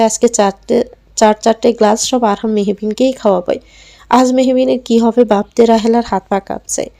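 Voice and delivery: usual speaking voice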